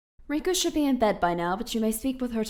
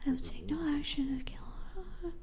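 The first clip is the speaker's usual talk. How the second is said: whispered reading; 3 semitones higher, 5 dB quieter than usual. Acted. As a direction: murmur the clauses under your breath. Reading contract under her breath.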